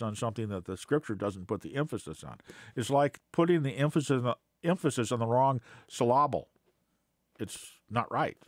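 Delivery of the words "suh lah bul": "syllable" stressed on the second syllable, suh-LAH-bul; misplaced accent. Shown as a demonstration. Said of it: "with the accent in the wrong place".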